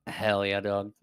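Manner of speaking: normal talking voice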